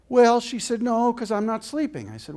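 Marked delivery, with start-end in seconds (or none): 0.1-0.4 put-on high, loud little Texas girl; little Texas accent. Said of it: "in her little Texas accent". 0.8-2.1 put-on high-pitched little Texas girl; little Texas accent. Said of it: "in her little Texas accent".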